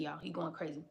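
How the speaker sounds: ordinary talk, nothing marked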